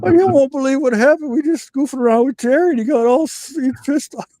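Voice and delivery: high, urgent retelling of his own panic; retold panicked rush. Telling a lady friend what happened, high and anxious. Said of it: "I was like".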